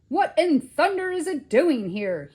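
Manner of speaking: high, loud indignant shout. A narrator's reading. Museum man finding a ghost in the cradle, indignant shout. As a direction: shout it in a temper. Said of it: "he shouted".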